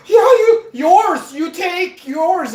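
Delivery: high, loud, hammed-up foreign seller; mock over-eager insistence; excited seller, imitated. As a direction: shout it eagerly as the other guy. Retold seller urging him to take it.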